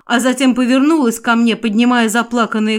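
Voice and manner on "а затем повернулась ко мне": ordinary delivery, nothing marked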